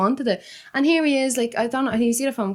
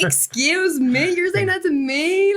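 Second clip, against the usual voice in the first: slow, drawn out and affronted; dramatic indignation; 7 semitones higher, much slower than usual. Retold or imitated. Self-confessed drama queen retelling the insult to her, indignant. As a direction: lean hard into the offence, pitch climbing. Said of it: "I know I'm so dramatic".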